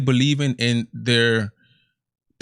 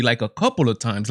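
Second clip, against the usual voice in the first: ordinary talk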